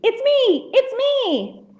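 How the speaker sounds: very high, happy reveal; gleeful sheep. A narrator's reading. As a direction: pipe up in a high voice to surprise children. Voices sheep revealing itself.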